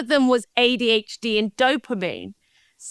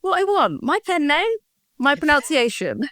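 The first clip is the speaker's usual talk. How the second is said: higher, mock-indignant insistence; playful defiance; 6 semitones higher than usual. Real, not acted. Guest teasing the host over her pen name, mock-defiant. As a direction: stand your ground with a laugh.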